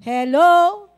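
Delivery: Hello called loud to the crowd. Real, not acted. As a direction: wake the room up with a shout. Calling out to congregation. (malakas na pagtawag sa kongregasyon)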